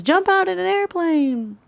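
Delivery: high, impish little voice in her head; a sly high voice daring her to jump; little inner voice. Retold or imitated. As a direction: tempt her like a mischievous inner imp. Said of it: "there's this little voice that goes".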